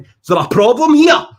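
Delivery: hammed-up tough guy asking for trouble; mock hard man. Outside a pub, he faces down the man bothering his mate, loud mock menace. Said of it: "And I turned round and I went".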